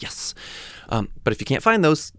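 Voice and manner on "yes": very soft little "yes"; hushed triumph. Retold or imitated. Finally found the name's pronunciation, hushed triumph. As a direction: imitate a private win under the breath. Acting out relief at finding it.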